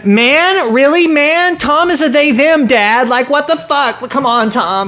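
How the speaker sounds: high, whiny mock daughter scolding dad; mock outraged daughter. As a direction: whine it like an offended teen girl.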